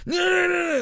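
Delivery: high-pitched stubborn no's; mock denial. Mocking [name] refusing to admit [name] won, high petulant.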